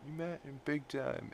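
soft high-pitched echo. Retold or imitated. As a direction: repeat it up high, softly. Brian's quote repeated, higher.

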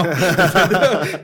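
laughs it out, drawn out and loud; laughing. Real, not acted. To the host, recalling the emotional meeting, laughing. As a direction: let a real laugh carry the word.

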